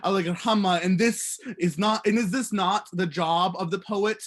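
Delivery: measured spoken-word delivery, building in intensity; poetry reading. Real, not acted. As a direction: recite a poem to a room, projected and increasingly urgent. Reads own poem aloud after introduction.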